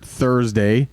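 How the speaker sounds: usual speaking voice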